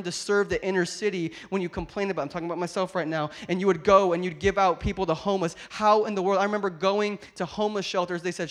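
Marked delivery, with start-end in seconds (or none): none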